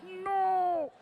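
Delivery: high strangled no of regret; a squeak of panic as the words escape; horrified no. Retold or imitated. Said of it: "and you're like".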